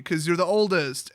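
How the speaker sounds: normal talking voice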